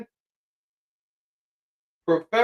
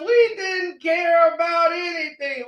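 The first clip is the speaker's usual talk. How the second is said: ordinary talk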